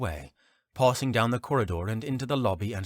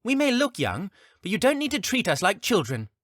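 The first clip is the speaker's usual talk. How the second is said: put-on high, huffy kid protest; indignant child voice; 13 semitones higher, 5 dB louder than usual. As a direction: push back like an offended youngster. Youngling protests being babied.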